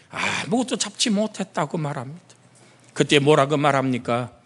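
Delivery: ordinary talk, nothing marked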